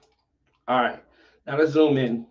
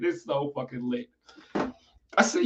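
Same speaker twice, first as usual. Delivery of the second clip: normal talking voice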